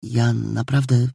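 unsure of what to say, feeling her way; a girl beginning nervously, slow and quiet; uncertain. A narrator's reading. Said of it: "zaczęła Małgosia niepewnie".

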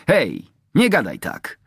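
high, sharp rebuke; a mild flash of temper; indignant. A narrator's reading. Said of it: "tupnął nogą i błysnął oczyma". (oburzenie, wysokim głosem)

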